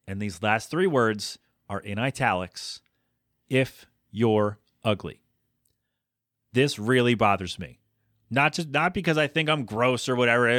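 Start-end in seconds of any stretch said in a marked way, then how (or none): 3.5-5.2 slow, weighted reading; deliberate. Shown as a demonstration. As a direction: land each word with disdain. Reading italic words slowly.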